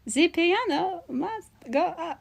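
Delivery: high, slow, soft singsong proclamation. Pretend. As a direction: echo the cartoon line quietly, stretching each word. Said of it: "VeggieTales reference automatically wins".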